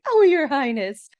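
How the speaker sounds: giggly Calliope. Retold or imitated. Calliope laughing at the prince.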